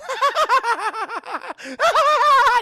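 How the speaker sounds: high, loud acted cackle; mad laughter. Imitating Catherine laughing alone in the room, in a shrill mad cackle. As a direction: manic laugh rising to a sustained shriek. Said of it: "just laughing".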